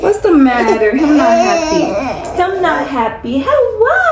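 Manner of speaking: slow high pouting coo then a squeaky hello. Real, not acted. Mom cooing at fussy baby.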